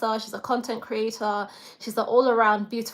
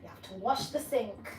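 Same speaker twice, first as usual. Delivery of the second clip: ordinary talk, nothing marked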